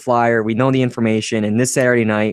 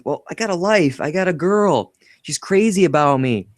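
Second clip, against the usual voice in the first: imitating Marty; 6 semitones higher than usual. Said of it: "And then he says".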